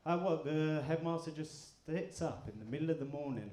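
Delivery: usual speaking voice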